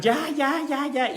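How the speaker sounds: high strained cries of give up; imitated pained yelling. Aikido teacher imitating a yelling attacker, high pained cries. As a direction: mimic an attacker yelling in pain. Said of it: "y de veras gritan".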